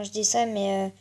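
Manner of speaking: ordinary talk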